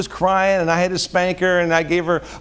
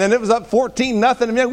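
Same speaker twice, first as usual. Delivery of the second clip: ordinary delivery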